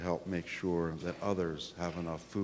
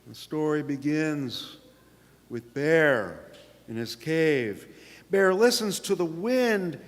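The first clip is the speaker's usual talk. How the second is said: put-on lively read-aloud for children, pitched up; story narration; 9 semitones higher, 8 dB louder than usual. A narrator's reading. Pastor reading picture book to congregation, storytelling voice. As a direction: tell it bright and bouncy to the kids.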